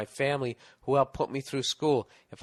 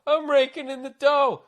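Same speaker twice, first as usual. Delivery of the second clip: much higher, slow, mock-delighted exclamation; mock thrilled; 13 semitones higher, 6 dB louder than usual. Busboy mocking his younger self, mock thrilled and much higher. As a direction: gush it like a naive kid who thinks he's rich. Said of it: "And I was like".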